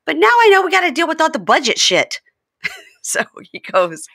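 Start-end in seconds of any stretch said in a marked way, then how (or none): 0.1-2.2 high, louder whiny excuse; hammed-up defensive Jasmine; mock Jasmine. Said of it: "And she goes".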